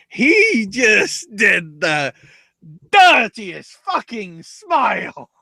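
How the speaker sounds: slow, high-pitched relish; hammed-up slow build; drawn-out emphasis. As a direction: stretch every word in gleeful disgust. Relishing the creepy smile.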